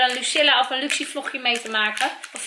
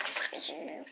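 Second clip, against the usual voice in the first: tender whisper; 15 dB quieter than usual. Real, not acted. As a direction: breathe it like a secret to your little one. Mother whispers to her baby. (fluisterend en teder tegen de baby)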